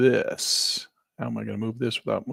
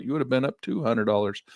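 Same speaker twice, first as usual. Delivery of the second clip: ordinary delivery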